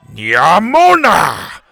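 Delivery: long, loud, rising battle cry; war-cry yell. A narrator's reading. Chief joining the charge at escaped kids, yelling. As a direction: yell it drawn out to lead a charge. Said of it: "he yelled".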